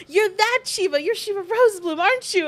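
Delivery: imitating someone suddenly placing a name; acted-out dawning recognition; excited recognition. Acting out a fan's excited recognition.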